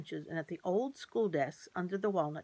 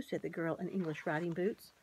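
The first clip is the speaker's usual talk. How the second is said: ordinary delivery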